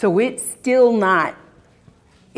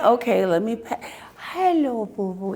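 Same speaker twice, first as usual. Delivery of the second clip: cooing at a dog; 3 semitones higher, 4 dB quieter than usual. Retold or imitated. Greeting a drooly dog.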